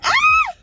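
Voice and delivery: very high, loud fangirl squeal; excited scream. Retold or imitated. Fan meeting her favorite singer, high loud squeal. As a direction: shriek like meeting your idol.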